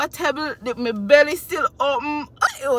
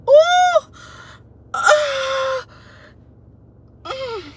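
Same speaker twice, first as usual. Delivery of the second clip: very high trailing sobbing sounds; emotional cry; 14 semitones higher, much slower than usual. Retold or imitated. Mother at birth, overwhelmed, sobbing up high. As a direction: let the emotion spill into wordless cries. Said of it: "when I say".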